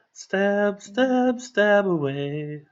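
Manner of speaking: high, slow sing-song battle chant; bard voice. Acted. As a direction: chant it like a grand bard rousing allies. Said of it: "He's gotta put his bard voice on".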